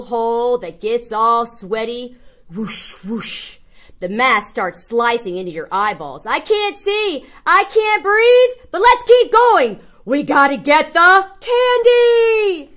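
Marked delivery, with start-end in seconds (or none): 2.5-3.6 soft breathy whoosh noises; quiet mock breathing sound; mask breathing whoosh. Breathing through mask hole. 6.3-12.7 put-on gasping but determined child; hammed-up panicky kid pushing on; desperate kid. Kid suffocating in mask.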